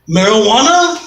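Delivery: high, questioning voice of a puzzled lover; lightly voiced non-English speaker, querying; imitated foreign woman. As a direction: ask it as a one-word question, pitch rising. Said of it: "young lady who didn't even speak English".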